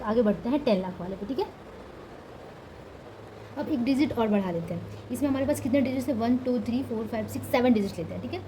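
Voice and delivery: normal talking voice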